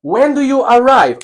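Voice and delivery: high clear model question, stress on arrive; teacher model question. Shown as a demonstration. Teacher reading the example to students, high and clear. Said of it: "teacher models question".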